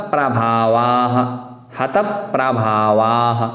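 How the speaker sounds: हत प्रभावाः drawn out slowly; careful recitation. Shown as a demonstration. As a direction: join हत into प्रभावाः. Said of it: "उसी प्रकार आगे देखना है".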